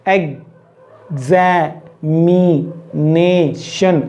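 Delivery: एग्जामिनेशन very slowly, a long pause after each syllable. Shown as a demonstration. Teacher inviting learners to say it, syllable by syllable. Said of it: "अलग-अलग articulator जब use होने लग जाते".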